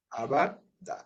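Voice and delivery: usual speaking voice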